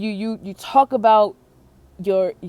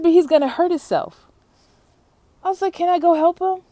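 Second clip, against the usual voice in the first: higher, pleading question to dad; worried call to dad; 10 semitones higher, 4 dB louder, faster than usual. Retold or imitated. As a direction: call out to him, alarmed. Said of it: "I said".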